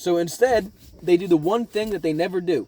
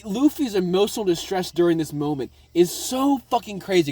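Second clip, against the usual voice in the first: ordinary talk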